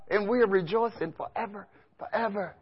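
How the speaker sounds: a gentle, peaceful answer to the screams; hushed rejoicing. Acted. Preacher answering the screams with soft, peaceful rejoicing.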